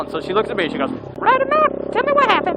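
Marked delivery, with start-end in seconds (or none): 1.2-2.6 mother voice. Retold or imitated. Mom voiced asking Ride-A-Map.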